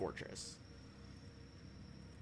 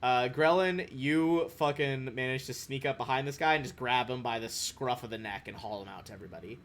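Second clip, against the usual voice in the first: normal talking voice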